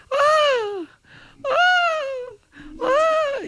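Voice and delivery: high falsetto screams with a cry; mock scream. The green grapes scream in the joke, high falsetto shrieks. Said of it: "empezaron a gritar las uvas".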